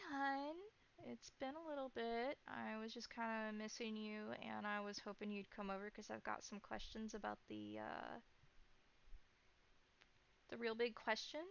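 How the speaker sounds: lightly voiced caller on the phone, tentative at first; a hint of a woman calling an old friend, unsure.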